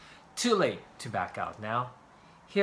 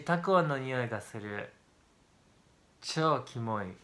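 ordinary talk

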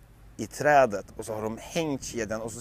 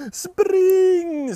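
much higher, loud yell to run; screaming; 11 dB louder than usual. Acted. Loke screaming at the gods to run, loud and high. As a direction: scream at everyone to flee. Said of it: "skriker Loke".

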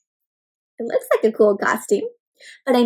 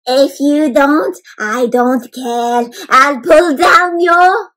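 Dracula voice; 6 dB louder, much slower than usual. A narrator's reading. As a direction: draw out every word like a hammy vampire. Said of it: "in his best Dracula voice".

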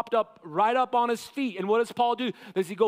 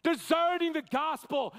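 frantic screaming; 5 semitones higher than usual. Acted. Paul shouting at deserting believers, frantic screaming. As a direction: rail at them, hair-pulling frantic. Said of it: "He did a frantic screaming".

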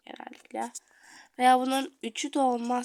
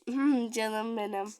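ordinary delivery

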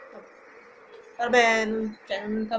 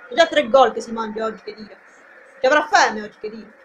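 ordinary talk, nothing marked

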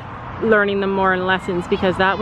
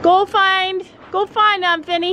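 urging the horse; 9 semitones higher than usual. Real, not acted.

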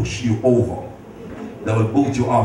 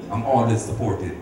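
usual speaking voice

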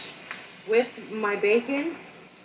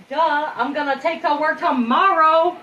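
higher, louder, obvious; duh tone; 6 semitones higher, 8 dB louder than usual. Real, not acted. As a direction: say it like it's obvious. Obvious-tone aside.

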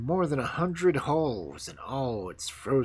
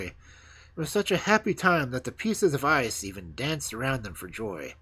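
plain reading voice

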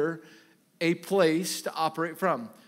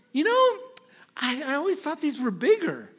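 much higher, light mock wife voice with a laugh; imitating wife; 9 semitones higher than usual. Preacher mimics his wife about the small burgers, high-pitched and laughing. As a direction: play the wife sweetly, laughing. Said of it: "my wife goes".

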